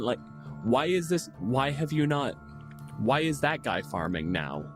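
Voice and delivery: ordinary talk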